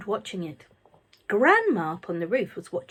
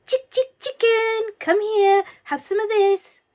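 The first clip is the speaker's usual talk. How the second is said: coaxing call; 13 semitones higher, 8 dB louder than usual. A narrator's reading. Coaxing a hen.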